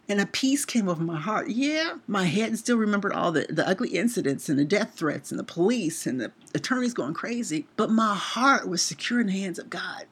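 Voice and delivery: normal talking voice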